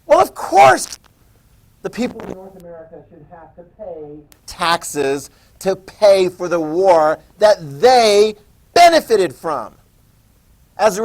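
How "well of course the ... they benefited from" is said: haughty British. Retold or imitated. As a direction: play the indignant Crown demanding payment. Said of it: "the British are like".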